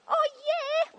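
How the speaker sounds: very high, delighted mock reply; eager agreement. Shopper happily helping a little lady, high eager voice. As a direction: squeal it sweetly, eager to help.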